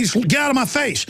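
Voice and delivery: firm brush-off. Retold or imitated. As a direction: tell him off hard, falling at the end. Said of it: "And I'll just be like".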